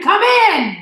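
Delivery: loud, high booming roar; captain roaring orders at the door. A narrator's reading. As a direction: bellow it like a cannon. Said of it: "a voice like the roar of a cannon".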